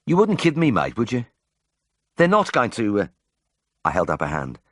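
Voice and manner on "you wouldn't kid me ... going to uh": high, pleading Brummie patient; worried Birmingham voice, starting high; anxious appeal. A narrator's reading. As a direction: plead for reassurance, breaking off unfinished. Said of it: "with a last appeal in his eyes".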